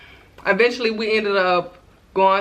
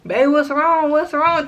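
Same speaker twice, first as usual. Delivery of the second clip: higher-pitched, urgent questioning; retold worried boyfriend, pitched up; imitated concerned boyfriend; 7 semitones higher, 3 dB louder, slower than usual. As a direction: voice a partner asking in alarm. Boyfriend finds her crying in bathroom.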